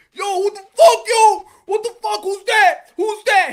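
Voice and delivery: loud, high challenge; imitated confrontational yelling; mock angry boyfriend. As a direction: act out a man demanding who this stranger is. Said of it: "and he goes like".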